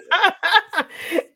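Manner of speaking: bright high burst of laughter. Real, not acted. Answering the interviewer, a high real laugh.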